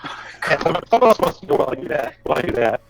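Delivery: normal talking voice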